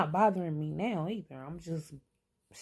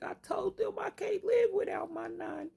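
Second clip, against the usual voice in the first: put-on much higher, childlike pleading voice; little grandson. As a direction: imitate a small boy clinging to his nanny. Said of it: "He was like".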